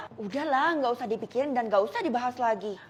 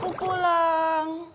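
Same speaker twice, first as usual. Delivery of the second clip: gloomy arrival; 3 semitones higher, 3 dB louder, much slower than usual. Acted. Comes home sad.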